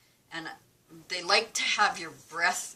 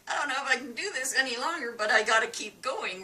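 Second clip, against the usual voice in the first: high, rushed inner protest; retold desperation; 7 semitones higher, 4 dB louder, faster than usual. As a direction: race through it as if nearly out of puff. Retold struggle during breathing test.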